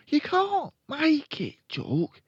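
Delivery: put-on high, loud, drawn-out little-boy voice; high-pitched boy character; young Fred. A narrator's reading.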